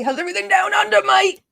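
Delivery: high, loud mock Australian accent. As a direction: ham up an Aussie greeting. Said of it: "My favorite accent".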